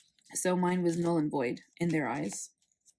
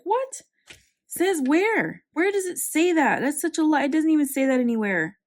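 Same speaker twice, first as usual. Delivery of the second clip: high, loud, indignant protest; annoyed outburst; 7 semitones higher, 8 dB louder than usual. Retold or imitated. Retelling her protest to the ID office, loud and indignant. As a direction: shout back in disbelief at the clerks. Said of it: "I was so annoyed".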